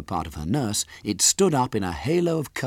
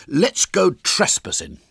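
put-on slow, loud, higher boy voice; William; 11 semitones higher, 6 dB louder than usual. A narrator's reading. Boy proposes mischief to friends, loud and slow. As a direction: propose it with boyish relish. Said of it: "said William".

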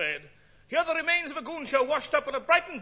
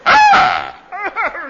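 loud gasp; 3 semitones higher, 15 dB louder than usual. Acted. Swallows the obstacle.

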